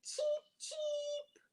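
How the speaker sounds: high, squeaky little peeps; baby bird cheeping; chick cheeps. A narrator's reading. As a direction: chirp like a tiny chick. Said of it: "the chicks say".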